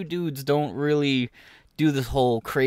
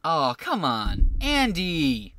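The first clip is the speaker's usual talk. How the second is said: usual speaking voice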